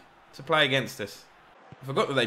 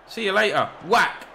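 excited; 8 semitones higher, 6 dB louder than usual. Real, not acted. Striker shoots at goal.

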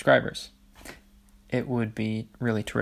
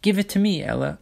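high, louder little-sister demand; voice rising; 8 semitones higher, 4 dB louder than usual. A narrator's reading. Olive demands the necklace from Ella, voice raised high. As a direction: start high and insistent, dropping on the name. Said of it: "her voice rising".

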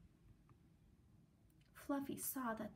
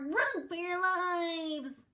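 slow, higher, louder cry; panicked shout; 8 semitones higher, 8 dB louder, much slower than usual. A narrator's reading. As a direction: draw out the alarm dramatically. Fleeing the monster.

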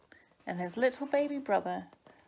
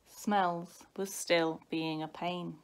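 usual speaking voice

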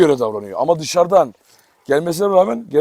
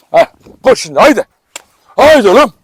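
calling the dog; 6 semitones higher, 8 dB louder than usual. Real, not acted. Köpeği koşmaya çağırıyor.

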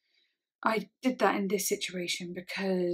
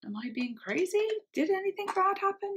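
higher, questioning voice, climbing sharply; confused self-questioning; 11 semitones higher than usual. Retold or imitated. Doubting herself after she confronted him, confused. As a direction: question yourself in rising bewilderment. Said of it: "I was confused".

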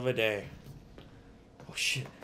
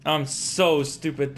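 ordinary delivery

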